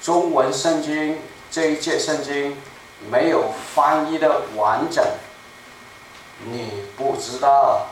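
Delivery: normal talking voice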